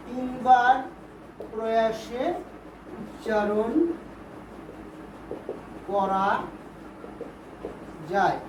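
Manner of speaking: word by word with long pauses; slow dictation. Shown as a demonstration. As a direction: dictate one word at a time, waiting after each. Teacher dictates definition word by word.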